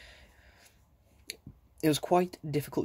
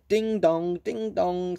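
higher, louder sing-song chime; excited clock chime; 5 semitones higher, 4 dB louder, slower than usual. A narrator's reading. Silky's clock chiming in excitement, higher chime. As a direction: ring out like an eager clock. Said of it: "in the greatest excitement".

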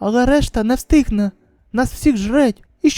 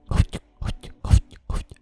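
soft unvoiced gulping noise; whispered gulps; 5 dB quieter, slower than usual. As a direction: swallow nervously aloud. (шепіт ковтання)

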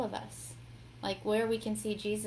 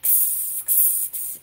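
soft voiceless sizzle; sizzling noise; 10 dB quieter, much slower than usual. Retold or imitated. Imitating the sound of him flipping and cooking, soft sizzles. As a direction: imitate food hissing in a pan.

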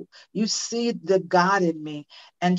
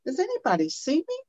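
high, pleading call that rises at the end; neglected child pleading; 10 semitones higher than usual. Retold or imitated. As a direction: voice an unseen child begging to be noticed. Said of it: "'cause they gotta be seen".

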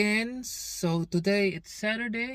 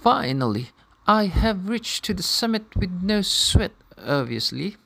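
documentary narration; 4 semitones higher, 14 dB louder than usual. Acted. Voiceover narration of the hike.

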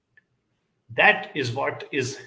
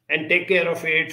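ordinary talk

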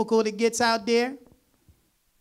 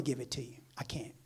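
whisper; 8 semitones lower, 15 dB quieter than usual. Real, not acted. Preacher drops to whisper mid-sermon.

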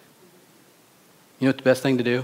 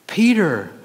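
higher, drawn-out calling of the name, falling at the end; exasperated reproach; 7 semitones higher, 4 dB louder than usual. Acted. Correcting Peter for erring again, exasperated. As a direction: scold by name.

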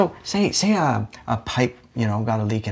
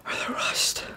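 breathless whispering; 8 semitones lower, 10 dB quieter than usual. Acted. Ill, breathless after exertion.

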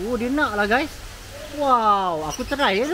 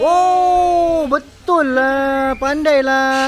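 delighted; 5 semitones higher, 5 dB louder, much slower than usual. Real, not acted. Animal performs the trick.

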